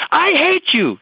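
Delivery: put-on high, whiny teenage cry; mock teen outburst. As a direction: play the teen lashing out.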